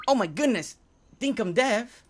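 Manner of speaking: high, loud startled outburst; flustered exclamation. Real, not acted. Streamer caught out by Dinkum Dev, loud and flustered. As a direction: cry out, caught off guard. Flustered as developer catches slip.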